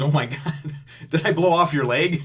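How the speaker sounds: loud, high gasped-out disbelief through laughter; mock shock. Luffy noticing the chef's peg leg, mock shocked. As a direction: act out an appalled outburst, amused.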